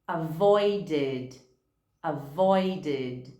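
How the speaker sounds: "avoided" ends in a full id syllable; teacher models "avoided" as avoid-id. Shown as a demonstration. Teacher shows the id ending.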